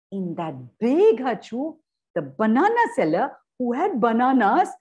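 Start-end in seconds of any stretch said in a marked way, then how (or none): none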